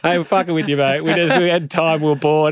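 laughing through it. Retold or imitated. Pranksters reveal the joke.

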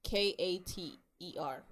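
letter by letter, K A T E R; spelling it out. Shown as a demonstration. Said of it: "And it's spelled".

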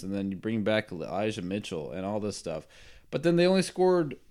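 normal talking voice

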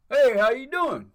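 high, loud, bright door greeter hello; loiterer greeting. Retold or imitated. Loiterer greets a customer at the store door, loud and high. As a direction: greet him like you work there, loudly.

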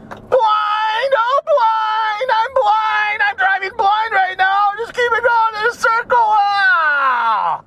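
high, sustained hammed-up cry; mock panicked wail. Driver blinded by the sun while circling, mock panicked wail. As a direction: wail like you are helplessly blinded, one held high pitch.

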